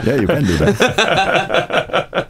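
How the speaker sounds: high slow laughter through words; laughing along with the teasing. Real, not acted. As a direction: chuckle the answer. Laughing reply.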